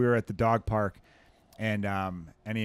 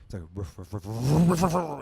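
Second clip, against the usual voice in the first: escalating barks; 3 dB louder than usual. Retold or imitated. Describing his boxer mix's bark, escalating dog barks. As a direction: mimic the dog going crazy, building up. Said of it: "this crescendo it hits".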